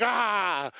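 high "Ah!" of frustration; retold burst of pent-up anger; exasperated cry. Preacher retells being mad before preaching, frustrated yell. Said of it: "now I'm really mad".

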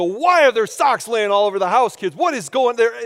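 put-on high, exasperated yell at the kids; retold yelling. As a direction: holler in mock outrage. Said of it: "I yell at my kids".

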